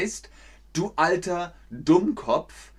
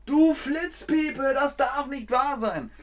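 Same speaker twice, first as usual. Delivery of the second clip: angry driver; 10 semitones higher than usual. Shown as a demonstration. A driver over a sharp stone, crying out in anger. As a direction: cry out over the ruined tire.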